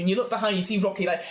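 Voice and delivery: normal talking voice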